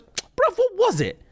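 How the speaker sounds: very high-pitched exasperated self-questioning. Real, not acted. Podcaster struggling to remember the list of foods, exasperated high voice. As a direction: voice shoots right up, puzzled at yourself. Exasperated at own faulty memory.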